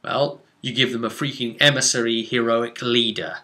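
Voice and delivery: ordinary delivery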